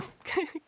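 high squealing giggle; ticklish laugh. Acted. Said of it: "くすぐったい".

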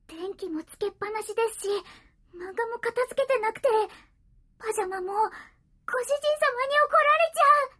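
rising, high and loud panic; flustered. Acted. Cat panics over the mess.

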